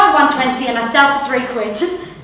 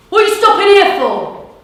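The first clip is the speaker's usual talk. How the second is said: angry demand; 8 semitones higher, 6 dB louder than usual. Acted. Angry cyclist confronts at lights.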